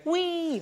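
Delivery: imitated high-pitched joyful "whee"; high gleeful whee. Old man skidding on a trolley, gleeful whee. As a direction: squeal a falling whee like a rider. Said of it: "I like noises as well".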